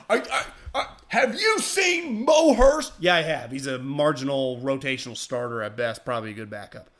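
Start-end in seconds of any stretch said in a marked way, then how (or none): 0.1-2.9 much higher, sputtering then slow incredulous question; mock outraged fan. Host mimics fans replying to his tweet, sputtering indignation. Mocks angry fans' reaction.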